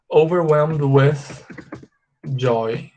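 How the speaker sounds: OVERWHELMED WITH JOY spaced out, pause before JOY; slow demonstration. Shown as a demonstration. Teacher shows the longer phrase to students, slowly. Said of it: "aumentam falando assim".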